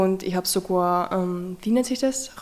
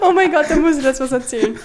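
excited laughing; 6 semitones higher, 6 dB louder, faster than usual. Real, not acted. Bursts out laughing, eager.

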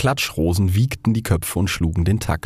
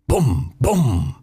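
booming bangs; 6 semitones higher than usual. Acted. Roman candle goes off, booming. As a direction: let it boom. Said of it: "dröhnte die römische Kerze".

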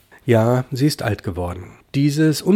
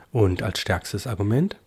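ordinary delivery

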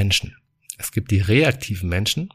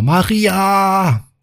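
high-pitched call across the house; 9 semitones higher, 5 dB louder than usual. Acted. Husband calling his wife from the bedroom, high call. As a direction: call her name from afar. Calls again from hallway.

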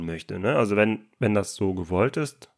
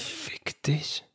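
whispered Fick dich, much softer; whispered retort; 5 semitones higher, 10 dB quieter than usual. Retold or imitated. Friend voicing the unspoken answer to the prying guest, whispered. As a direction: hiss it under your breath. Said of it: "Und du nur so".

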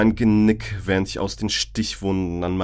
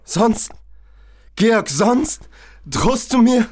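can't believe he threatens her; shocked disbelief; 12 semitones higher, slower than usual. Acted. Aline reacts to Georg's threat, high and shocked.

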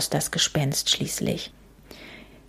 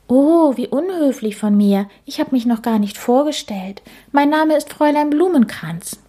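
higher, lightly voiced ghost lady, a little prim; polite ghost lady; 8 semitones higher, 3 dB louder than usual. A narrator's reading. Ghost introducing herself to Käthe, high polite voice. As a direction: play the ghost as a courteous young lady.

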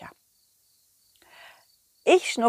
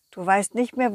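ordinary delivery, nothing marked

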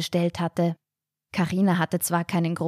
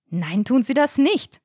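higher, emphatic objection; cutting in; 6 semitones higher, 4 dB louder than usual. A narrator's reading. Daughter cutting in on mother's order, emphatic and higher. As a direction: jump in to overrule. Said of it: "fuhr Carina sofort dazwischen".

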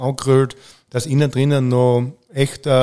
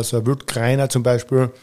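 ordinary talk, nothing marked

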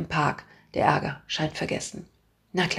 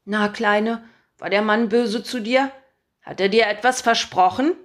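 put-on louder, coaxing old-lady voice; sweet old woman; 4 semitones higher, 6 dB louder than usual. A narrator's reading. Old woman coaxes the crying girl, sweetly suspicious. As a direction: coo to the child like a worried granny.

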